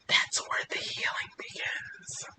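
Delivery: soft hushed whisper. Real, not acted. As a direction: lean in and whisper it. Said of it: "getting all sentimental".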